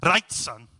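a loud, failed try at a Welsh dad accent; Welsh accent attempt. Shown as a demonstration. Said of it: "I just need to get into Gavin and Stacey mode".

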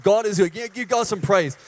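loud call for applause; rousing praise. Real, not acted.

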